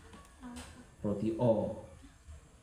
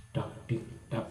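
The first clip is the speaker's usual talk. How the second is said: heartbeat noise; 3 semitones lower, 6 dB quieter than usual. Retold or imitated. Imitating nervous heartbeat.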